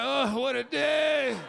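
put-on slow, overdone groan of a weary teen; hammed-up teen fatigue, drawn out slow; dramatic sigh. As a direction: play up the long day. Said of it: "all dramatic".

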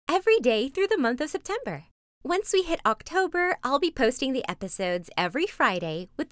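high, loud, upbeat announcement; bright promo read. Acted. Upbeat promo intro to camera.

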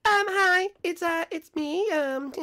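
very high put-on voice, hesitant, dissolving into a giggle; mock voicemail caller. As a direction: play a shy caller leaving a message. Fake voicemail message after the beep.

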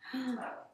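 storyteller's sudden intake of breath; short, high gasp; dramatic gasp. Acted. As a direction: gasp in mock suspense.

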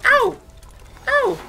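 high, loud cartoon ouch; mock ouch. Gamer yelps high and loud when hit. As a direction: yelp like a toon getting hit.

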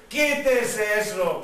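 slow, drawn-out emphatic order; insistent command. Retold or imitated. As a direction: stretch each word of the command, brooking no excuse. Dios repite la orden, insistente. (orden insistente, lenta y marcada)

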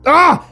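high sudden cry of pain; pained yell. Acted. Philip cut by crystals, pained yell. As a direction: yelp as something cuts the foot. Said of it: "he yelled as the crystals cut".

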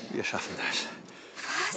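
slow, unvoiced whisper; quiet murmur. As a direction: breathe it out under your breath.

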